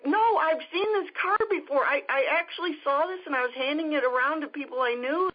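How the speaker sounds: high, emphatic retold exclamation; acting out an excited stranger, high-pitched; excited insistence. Stranger insists she knows the card.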